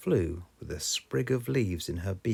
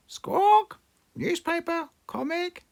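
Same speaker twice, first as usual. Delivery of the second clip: very high, louder parrot echo; parrot squawk; 6 dB louder than usual. A narrator's reading. Polly copying Danny Dog, squawking very high. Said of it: "Polly Parrot copied".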